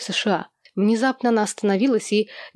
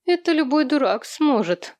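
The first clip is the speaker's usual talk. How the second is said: high-pitched envious remark; a touch of envy, pitched up; 7 semitones higher than usual. A narrator's reading. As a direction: put it down enviously. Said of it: "с завистью прокомментировала Джо".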